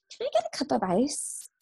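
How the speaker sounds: high, sweet asking voice; mock needy patient. Nurse imitating a patient's endless requests, high and sweet.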